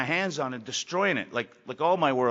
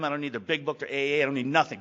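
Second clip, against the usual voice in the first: ordinary talk, nothing marked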